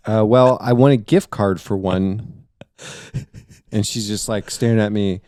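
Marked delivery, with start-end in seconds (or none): none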